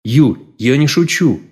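slow, plaintive protest; plaintive drawl. A narrator's reading. Man protesting to his teasing girlfriend, plaintive drawl. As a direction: draw it out pleadingly. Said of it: "жалобно протянул Денис".

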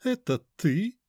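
high, slow, mannered lady. Acted. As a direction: call out in a lofty mannered tone. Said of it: "высокий манерный голос".

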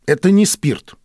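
a touch of wounded pride; offended. A narrator's reading. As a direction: object as if insulted. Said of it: "обиженно сказал доктор".

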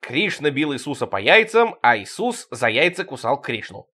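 ordinary delivery

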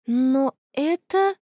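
halting, high and very slow; stammering. A narrator's reading. Friend recognises the face in the photo, stammering. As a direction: falter word by word in shock. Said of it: "заикаясь, произнесла она".

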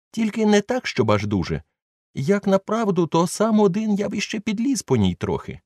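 high, sheepish servant excusing himself; Jupiter's voice. A narrator's reading. Old servant brushes off fear of the beetle, high and sheepish. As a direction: pitch up as the scolded old servant.

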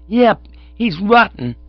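put-on high, loud, slow kid verdict; definite child. A narrator's reading. Child condemning Cyril to pop, high and definite. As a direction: pronounce judgement as a small child. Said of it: "He became more definite".

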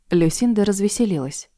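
ordinary delivery, nothing marked

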